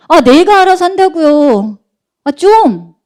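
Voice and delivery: put-on exasperated teen, high, loud and drawn out; 사춘기 아이. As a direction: whine back at a nagging parent, dragging the words. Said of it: "그래서 아이들이 뭐라 그러죠, 사춘기가 되면?". (짜증 내는 사춘기 말투)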